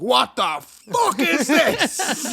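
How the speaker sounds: high, slow outraged yell; mock Gordon yell. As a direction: drag out the fury like an angry chef. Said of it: "And he's like".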